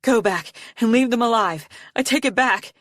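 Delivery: high, desperate pleading cry; cried out. A narrator's reading. Arctic trying to reverse the killing, crying out. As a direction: beg loudly to undo it. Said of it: "Arctic cried".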